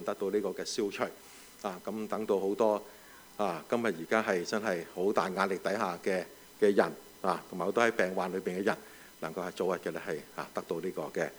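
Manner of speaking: hushed, earnest prayer; quiet prayer. Acted. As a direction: pray softly for healing. Prays for the sick.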